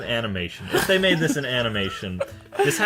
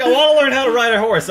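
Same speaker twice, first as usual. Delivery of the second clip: high, loud, rushed mimicry; loud mocking high voice; mock Laura; 11 semitones higher, 9 dB louder, faster than usual. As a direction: imitate an eager girl, fast and high. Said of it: "she's like".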